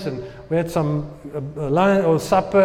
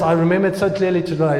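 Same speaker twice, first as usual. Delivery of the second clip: ordinary talk, nothing marked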